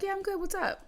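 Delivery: lightly voiced brother.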